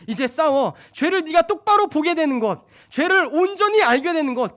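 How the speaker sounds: loud, high, fervent preaching; preaching at full force. Acted. Preacher stirring the congregation, loud and fervent. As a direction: drive the confession up to the rafters. Preacher voices the Spirit loudly.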